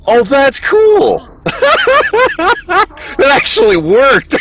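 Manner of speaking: high, delighted and laughing; thrilled it actually worked; gleeful surprise breaking into laughter; excited delight. Real, not acted. Delighted when the trick works.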